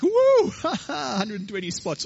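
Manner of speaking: high, loud whoop breaking into laughter; so excited. Acted. Leopard thrilled by his new spot count, excited whoop. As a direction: whoop with delight, then draw the words out. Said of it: "He was, like, so excited".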